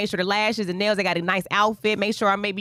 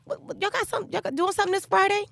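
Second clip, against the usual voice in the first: much higher, light voice on a casual call; imitated girlfriend; 11 semitones higher than usual. Girlfriend calls to ask about Friday, imitated casual question. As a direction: imitate a friend fishing for news.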